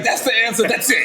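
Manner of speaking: high teasing voice; mocking the crowd. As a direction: imitate everyone agreeing. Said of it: "Everybody looked at me like".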